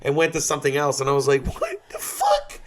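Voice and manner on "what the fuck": laughing shout. Retold or imitated. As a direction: yell it through a laugh, exasperated. Frustrated at the unfinished story.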